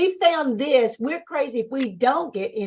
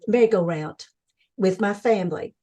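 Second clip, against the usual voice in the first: normal talking voice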